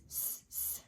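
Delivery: s, s hissed with no voice; s sound. Shown as a demonstration. Teacher isolates the final s sound for kids. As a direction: hiss s, s like a snake softly. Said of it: "the last letter sound I hear".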